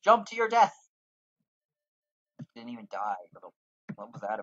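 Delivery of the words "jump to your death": high, loud mock command; playful yell. Real, not acted. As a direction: cheer her off the ledge. Yelling at her character.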